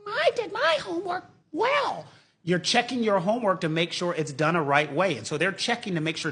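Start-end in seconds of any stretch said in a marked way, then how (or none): 0.1-2.1 put-on much higher, kid-like pupil voice; child's voice. Pupil telling Miss Krabappel about homework, high childish voice. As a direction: chirp it like a proud little student.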